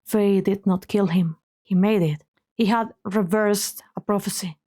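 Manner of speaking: ordinary talk, nothing marked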